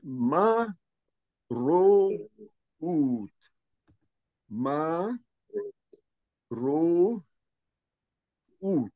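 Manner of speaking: "ma, ro, ut" each syllable split and held apart; slow dictation of each syllable. Shown as a demonstration. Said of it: "to be read independently". ("ما، رو، أوت" مقطعًا مقطعًا)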